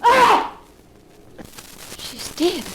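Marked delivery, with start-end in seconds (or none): 0.0-0.6 sharp high scream of horror; a shocked scream as she sees the axe. Acted. Florence screams at the killing.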